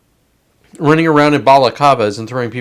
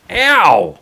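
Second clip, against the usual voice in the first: sharp yelp of pain, higher and louder; real pain; 5 semitones higher, 5 dB louder than usual. Real, not acted. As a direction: yelp as the stick hits your wrist. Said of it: "Surprisingly hurts really bad".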